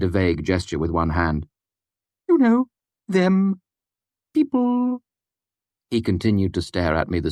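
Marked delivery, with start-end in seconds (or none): 2.3-5.0 put-on high, muffled odd old man; an oddball asking from behind a door; eccentric old man. A narrator's reading. Puppet voiced.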